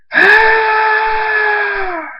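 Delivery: long, very high shriek; sustained acted battle scream; power-up scream. Thunderstorm unleashing his power at Dave, long high scream.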